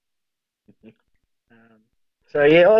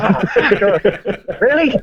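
high, laughing protest; mock disbelief; 8 semitones higher than usual. Stung kid told he still has to fetch smokes, high disbelief. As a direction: whine it in disbelief. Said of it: "I was like".

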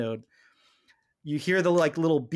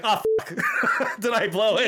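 higher, laughing mock panic; laughing Janeway; 5 semitones higher than usual. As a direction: play Janeway's oops moment through laughter. Janeway's reaction, voiced laughing.

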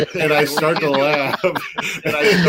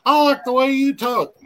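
Sling Blade quote; 13 semitones higher than usual. Retold or imitated. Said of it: "he's, he's like".

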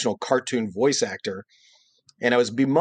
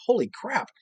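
amazed; 10 semitones higher than usual. Retold or imitated. Child amazed at drawing.

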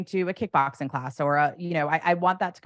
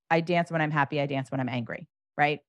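ordinary talk

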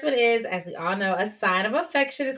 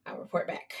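whisper; 10 dB quieter than usual. Real, not acted. Signing off a topic.

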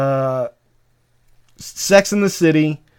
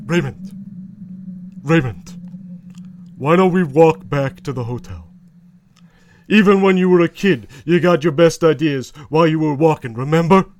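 Robert Barone. Acted. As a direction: talk your excited brother down evenly. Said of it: "Robert Barone".

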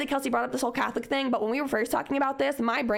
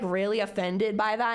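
ordinary talk, nothing marked

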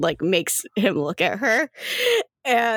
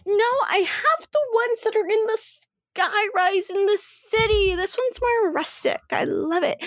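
excited gushing; 11 semitones higher than usual. Acted. Rochelle gushing over the house.

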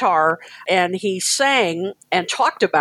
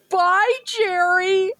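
put-on much higher, slow girly farewell; 12 semitones higher, much slower than usual. Fans saying bye to the singer, girly voices. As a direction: coo it like giggly fangirls. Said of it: "the girliest voices".

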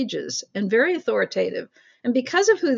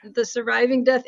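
normal talking voice

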